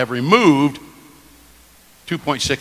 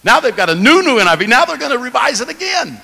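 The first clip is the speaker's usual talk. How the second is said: sarcastic; 9 semitones higher, 6 dB louder than usual. Real, not acted. Mocking repeated new versions.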